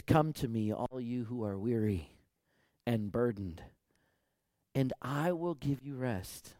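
slow, solemn scripture recital; scripture reading. Acted. Pastor reading Jesus to the congregation, solemn and slow. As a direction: read Jesus' words with weight.

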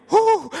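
high, loud whew of embarrassment; playful whoosh of relief. Real, not acted. Reacts to own joke.

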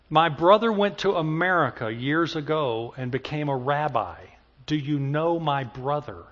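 ordinary delivery

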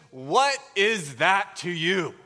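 slow, deliberate calm answer; Jesus gently redirects Peter; calm firm reply. Retold or imitated. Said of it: "Jesus' response is".

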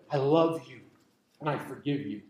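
low, soft reassurance; tender God. Retold or imitated. God answering a child asking forgiveness, low and tender. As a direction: drop low and gentle, unhurried. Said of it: "and God said".

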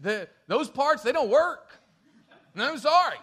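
high, loud, laughing through the excuse; laughing mock apology. Abraham explaining to God his body is too old, laughing. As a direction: chuckle it out as a rueful joke.

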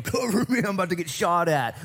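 mock panic under fire; war movie soldier. Preacher acts out a war movie charge, hammed-up high cry.

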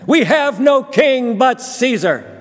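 high, loud, slow furious scream; furious mob scream. Acted. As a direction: roar it back as a raging mob. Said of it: "they screamed back".

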